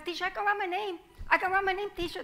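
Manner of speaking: high, quick, eager pleading; eager child. Acted. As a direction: bounce with excitement to get the teacher's attention. Child begging the teacher again.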